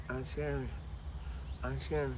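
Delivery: soft, hesitant calling of a name; timid wake-up call. Retold or imitated. Timid kid waking sleeping aunt.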